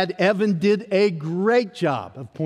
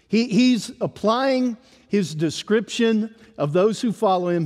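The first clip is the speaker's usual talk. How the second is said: usual speaking voice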